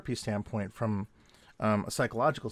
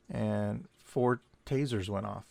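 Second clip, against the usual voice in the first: usual speaking voice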